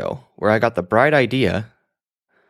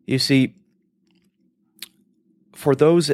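ordinary talk

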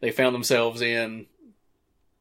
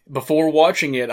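ordinary talk

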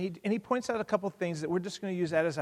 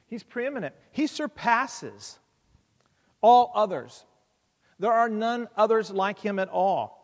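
higher and louder, stretched-out proclamation; preaching; 4 semitones higher, 5 dB louder than usual. Acted. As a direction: declare it boldly to the church. Preaching point one.

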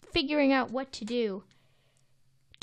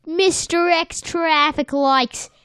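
put-on newsboy Bronx accent; 2 semitones higher, 7 dB louder than usual. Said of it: "which accent are we doing now".

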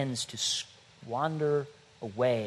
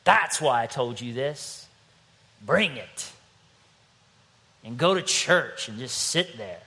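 normal talking voice

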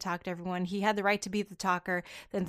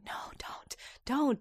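hushed, whispered warning; mock pleading whisper; 6 semitones higher, 6 dB quieter, slower than usual. Reader privately begging a character to stop talking, mock pleading whisper. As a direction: whisper it as a playful plea to stop.

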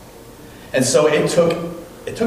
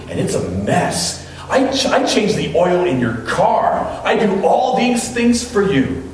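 imitating an indignant fiancé, mostly pitched up; retold furious outburst; 6 semitones higher than usual. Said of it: "He's furious".

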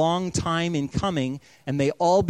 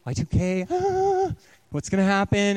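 hammed-up panic with a squeal on ah, higher; mock panic; 5 semitones higher than usual. Preacher mocking Y2K doomsayers, hammed-up panicky squeal. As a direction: play a panicked doomsayer for laughs. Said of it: "everyone starts panicking".

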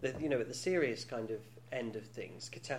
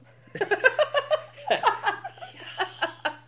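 long, high, loud belly laugh; burst of laughter; 11 dB louder than usual. Real, not acted.

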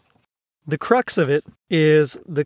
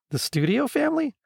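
high-pitched incredulous question; puzzled echo; 9 semitones higher, 4 dB quieter than usual. Acted. Lisa querying Gonzo's mistaken idea, puzzled. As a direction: repeat it as a baffled question, voice raised.

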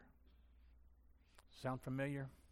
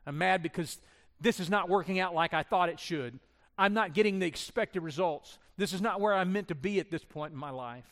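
louder, pitched-up mock frustration; frustrated outburst; 4 semitones higher, 10 dB louder than usual. Pastor voices the frustrated believer, louder and higher. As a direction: vent it like plans went wrong. Voicing frustration about life.

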